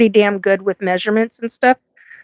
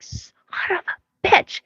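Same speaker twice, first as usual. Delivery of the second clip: whispered curse; 10 semitones higher, 6 dB quieter, slower than usual. Retold or imitated. Retold curse at cloaking ship.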